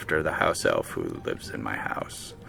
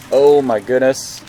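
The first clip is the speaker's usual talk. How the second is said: overwhelmed exclaim; 4 semitones higher, 7 dB louder than usual. Real, not acted. Overwhelmed in the fight.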